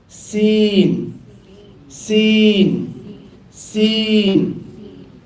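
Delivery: letter names called out high and loud, each one falling; model for repetition. Shown as a demonstration. Teacher reciting the whole alphabet aloud for students, loud and high. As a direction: call each letter clearly for the class to echo. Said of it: "repeat after me".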